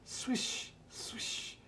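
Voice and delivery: breathy whispered swish, fading off. Acted. Telling a child a story, whispering the car wheels' swish. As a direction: let it hiss past like tyres on a street.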